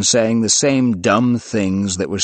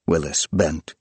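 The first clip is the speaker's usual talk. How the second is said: usual speaking voice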